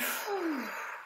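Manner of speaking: soft audible calming exhale; deep breath out. Acted. Mother and Ava pause in noisy park, exhaling deeply. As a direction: blow the breath out gently. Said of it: "let out a deep breath".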